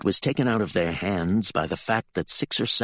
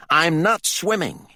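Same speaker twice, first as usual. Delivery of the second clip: high, loud, slow defiance; angry refusal; 11 semitones higher, 5 dB louder than usual. A narrator's reading. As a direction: refuse hotly, each word stressed. Furious Gaza refuses, knife drawn.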